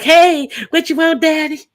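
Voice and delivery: high-pitched, playfully eager dog voice; happy mock greeting. Happy dog answering when called, in a high, excited voice. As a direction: squeal it like an overjoyed pet running up. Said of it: "she all happy like".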